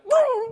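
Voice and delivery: very high mock howl; imitated yelp of pain. Comic imitating the caned man, high puppy-like yelp. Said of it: "the guy goes like".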